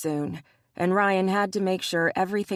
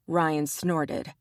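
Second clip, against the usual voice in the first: plain reading voice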